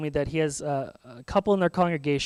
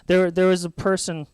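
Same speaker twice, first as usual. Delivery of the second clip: ordinary talk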